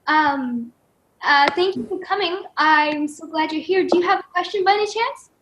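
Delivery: ordinary talk, nothing marked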